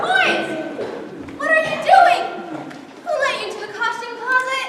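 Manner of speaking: high, loud scolding shout; scolding call. Acted. Adult catching the boys in the costume closet, loud scolding. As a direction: catch them in the act.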